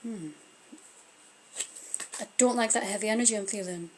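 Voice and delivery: ordinary talk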